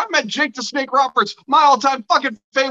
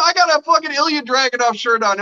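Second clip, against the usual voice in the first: usual speaking voice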